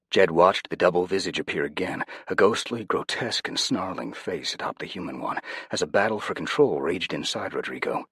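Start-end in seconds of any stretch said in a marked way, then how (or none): none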